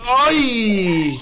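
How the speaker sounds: high, loud drawn-out cry; long startled yell. Real, not acted. Gamer hit by a fireball, long high loud cry.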